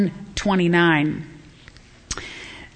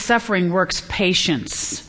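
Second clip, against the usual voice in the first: normal talking voice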